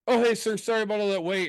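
high, lilting phone-agent voice; imitated apologetic call-center agent; customer service agent. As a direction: come back on the line with a high-pitched apology. Voices agent returning from hold.

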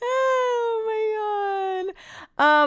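very high, thin drawn-out squeal; high-pitched mock cry; laughing wail. Real, not acted.

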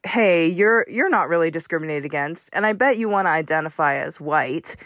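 normal talking voice

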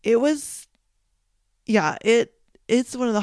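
choked up, struggling to get the words out; voice breaking. Real, not acted. Mom recalling her hurt son's stitches, voice breaking. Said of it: "it's a little emotional right now".

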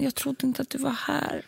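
small, hurt quote, lightly acted; imitated tearful child. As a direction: softly imitate a child starting to cry. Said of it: "så bara börjar hon storgråta".

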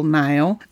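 "Nile" in one short syllable. Shown as a demonstration. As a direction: say "Nile" as one beat, eye vowel. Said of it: "kind of a little bit more short, one syllable".

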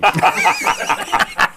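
real burst of laughter. Real, not acted. Co-host hears about the Chuck E. Cheese gift, laughing.

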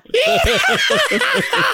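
a light mock chuckle, high and easy; carefree laugh. Husband laughing off his wife's worries, light mock chuckle. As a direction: laugh it off like nothing matters.

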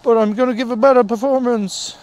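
high, loud, slow Irish horse voice; a sorry Irish horse promising better, slow and loud. Pretend. Player voicing the horse apologising to fans, high Irish lilt. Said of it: "He's a slightly Irish horse".

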